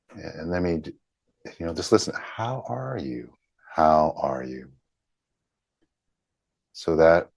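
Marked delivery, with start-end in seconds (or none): 2.1-4.7 soft, slow, inward asking; gentle meditative question. Acted. Guiding listeners to feel the question, softly. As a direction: let it land gently.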